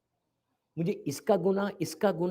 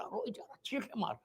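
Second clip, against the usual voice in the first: hushed telling; 15 dB quieter, faster than usual. Acted. Describing the man's weeping.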